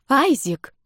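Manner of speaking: high startled cry of a name; indignant exclaim. A narrator's reading. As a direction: cry his name in shock. Outraged as he strips her.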